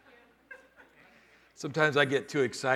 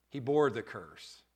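ordinary talk

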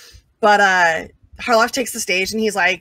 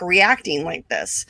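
normal talking voice

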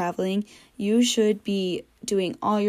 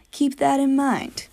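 normal talking voice

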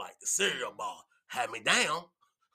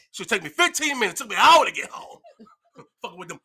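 usual speaking voice

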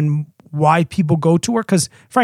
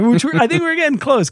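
normal talking voice

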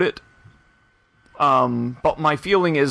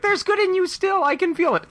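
much higher dramatic movie-line voice; reenacting Star Wars. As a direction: ham up a pleading Jedi line. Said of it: "they reenact the lines from Return of the Jedi".

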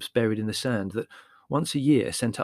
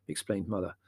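plain reading voice

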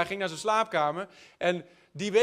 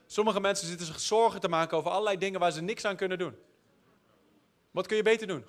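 usual speaking voice

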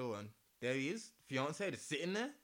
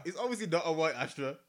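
normal talking voice

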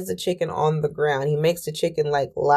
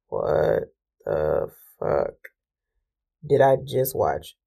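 much lower, slow, dragged-out shock; low stunned disbelief; 7 semitones lower, 3 dB quieter, slower than usual. Retold or imitated. After the brick shatters, low slow stunned disbelief. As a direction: let it sink low and slow in awe.

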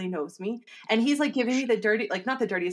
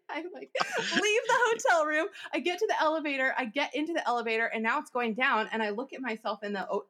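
usual speaking voice

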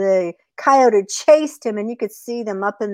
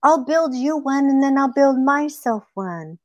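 soft, higher-pitched begging; pleading rabbit; 4 semitones higher, 3 dB quieter than usual. Acted. Rabbit begs coyote to share the hole, soft pleading. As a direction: coax sweetly in a small voice.

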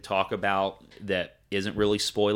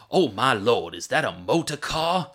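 put-on Benoit Blanc's Southern accent; 4 semitones higher, 5 dB louder than usual. Fan quoting the bewildered sleuth seeing the car, mock Southern accent. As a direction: imitate a goofy, astonished Southern gentleman. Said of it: "calls it a motor car in his fucking accent".

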